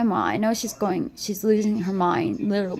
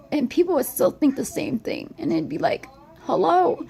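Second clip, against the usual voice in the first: tearful, voice often lifted higher; real crying; 4 semitones higher than usual. Real, not acted. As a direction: talk through tears, sighing between thoughts. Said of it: "I'm sorry I'm crying".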